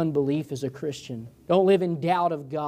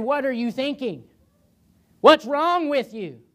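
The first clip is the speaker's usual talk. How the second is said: higher, louder, slowed rebuke; Jesus rebuking; 9 semitones higher, 5 dB louder than usual. Retold or imitated. Jesus rebuking unbelieving disciples, raised rebuking voice. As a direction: scold them like a disappointed teacher.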